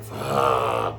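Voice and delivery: a long, low, defeated groan on the word; groaned curse. Retold or imitated. Fed-up people cursing under a bad ruler, groaning. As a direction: sink into it like a weary groan. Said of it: "That's what that groan is like".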